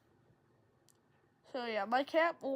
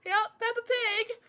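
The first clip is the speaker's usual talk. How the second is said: laughing it off; 9 semitones higher, 7 dB louder, slower than usual. Retold or imitated. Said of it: "laughed it off".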